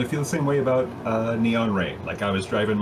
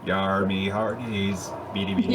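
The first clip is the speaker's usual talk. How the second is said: put-on pirate accent; 3 semitones lower than usual. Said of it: "What sort of accent do space pirates have?".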